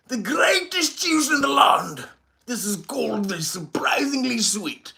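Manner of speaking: British voice. Pretend. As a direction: sell the juice like a grand British announcer. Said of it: "Had to put my British voice on for you".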